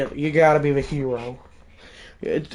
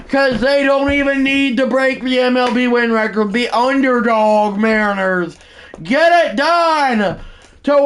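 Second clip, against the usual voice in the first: raised, half-sung broadcast voice; announcer wrap-up; 9 semitones higher, 4 dB louder than usual. Acted. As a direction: keep riding the celebration like a TV call.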